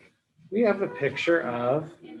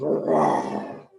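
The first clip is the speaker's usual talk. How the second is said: growl; 3 dB louder than usual. A narrator's reading. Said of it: "and growled".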